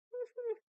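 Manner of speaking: a short high burst of laughter; sudden laugh. Real, not acted. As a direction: laugh it off, embarrassed. Laughs after denying being furry.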